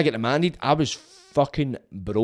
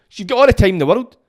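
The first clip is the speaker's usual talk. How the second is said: loud mock advice; 9 semitones higher, 8 dB louder than usual.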